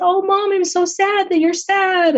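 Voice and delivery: put-on high, childlike voice, gently sympathetic; little daughter's voice. Nine-year-old daughter comforting her crying mom, childlike high voice. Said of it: "And she said".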